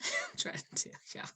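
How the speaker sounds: hushed, voiceless whisper after a laugh. Real, not acted. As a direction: whisper it, barely any voice. Repeats line after moving song.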